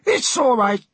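high-pitched captain voice; captain character. Acted. Captain reassures the sailor, high character voice. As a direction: pitch it well up, cutting the sailor off.

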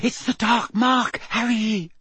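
high, slow moan of dread; frightened moan. A narrator's reading. Terrified Hermione tugs at Harry, frightened moan. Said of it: "Hermione moaned".

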